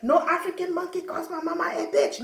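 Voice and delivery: outraged mock tough girl; furious girl. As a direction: play the big girl ready to fight. Angry big girl threatening.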